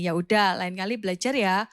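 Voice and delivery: father comforts his daughter after the test; gentle father. Retold or imitated. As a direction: reassure your crying daughter on the phone. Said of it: "papanya Kak Novi bilang gini".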